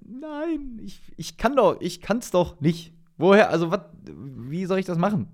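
high, whining mock protest; reluctant prophet protesting. Jeremiah tells God he can't do it, reluctant protest. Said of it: "wie er sagt".

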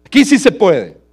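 loud tempter. Acted. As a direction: shout "Aquí" as a big enticing promise. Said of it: "y ellos le dicen". (el falso maestro gritando "Aquí sí se puede")

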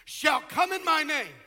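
loud, driving pulpit delivery; full-force preaching. Real, not acted. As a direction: hammer key words and stretch them out.

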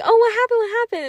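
high, urgent questions; excited mock questioning; imitating eager friends. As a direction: pitch it up as nosy friends.

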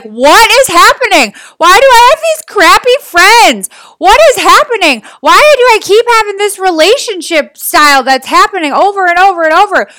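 high-pitched, dramatized exasperation; exasperated complaining. Acted. Coach acting out her own repeated frustrations, exasperated. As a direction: whine in mock despair, pitch swooping up.

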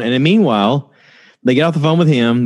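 ordinary talk

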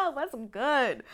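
loud, high burst of laughter into the words; laughing. Real, not acted. Host laughing at a Bible-name slip, laughing. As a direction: crack up at your own slip.

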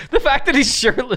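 high giggling through words; laughing. Real, not acted. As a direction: laugh while you say it. Laughing at clip.

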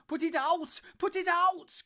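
very high, loud scream; panicked cry. A narrator's reading. Trapped hobbit begging friends to douse fire, screaming. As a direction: scream it out in terror. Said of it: "cried Merry".

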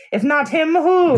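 loud, high mock outburst; clown voice shout. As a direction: yelp it like a hammy clown. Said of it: "getting the clown voice".

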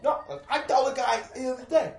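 hammed-up tough guy shout, cracking up; mock furious Brooklyn threat. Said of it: "These people are like".

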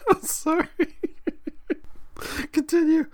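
high, squeaky through laughter; crying with laughter. Real, not acted. Listener begs storyteller to continue, crying with laughter. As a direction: apologize helplessly through giggles. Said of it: "I'm literally crying".

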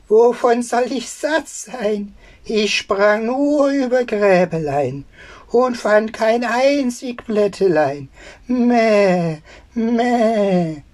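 high, whining sing-song goat; spiteful bleating goat. Acted. Spiteful goat lying to the tailor in a high bleating voice. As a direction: chant the complaint high, with long bleats. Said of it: "Das boshafte Tier antwortete".

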